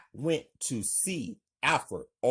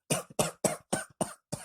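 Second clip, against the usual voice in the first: harsh, rattling fit of coughing; sick woman's racking cough; hacking cough. Acted. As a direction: cough hard and long. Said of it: "coughing really badly".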